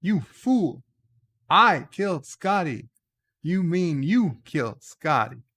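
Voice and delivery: mock outrage, leaning hard on the pronouns; Captain Kirk. Captain turning the blame on his officer, mock indignant. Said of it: "And he's like".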